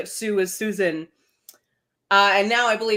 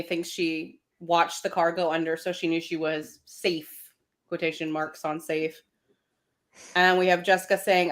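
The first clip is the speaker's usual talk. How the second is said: normal talking voice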